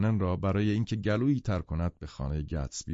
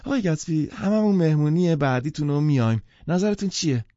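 high, loud tipsy socialite woman; effusively sociable, pitched up and loud; warm, friendly; 7 semitones higher, 7 dB louder than usual. A narrator's reading. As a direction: gush an invitation after a second whisky. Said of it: "گرم و صمیمی شد".

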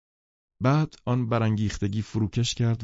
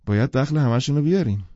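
plain reading voice

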